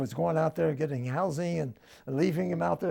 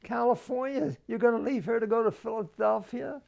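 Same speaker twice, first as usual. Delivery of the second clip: high, incredulous teasing questions; imitating friends' playful, raised-pitch incredulity; kidding disbelief; 6 semitones higher than usual. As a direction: tease him with mock astonishment. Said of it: "they were all kidding him".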